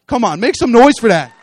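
loud call for the congregation to cheer; a real preacher's shout to the crowd; rousing the crowd. Real, not acted. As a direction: whip up the church to make noise.

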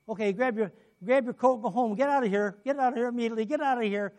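urgent pastor shooing someone away; hurrying someone out. Acted. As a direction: usher them out urgently, pitch up. Acting pastor hurrying healed person out.

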